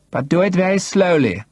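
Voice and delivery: high, measured order from a woman; commanding. A narrator's reading. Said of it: "she ordered me".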